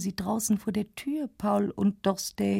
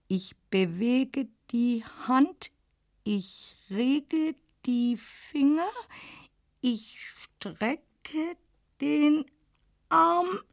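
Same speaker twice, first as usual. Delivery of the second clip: slow straining build; 4 semitones higher, much slower than usual. A narrator's reading. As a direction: push each word out, building. Else erwacht mühsam. (mühsamer, steigender Kraftakt)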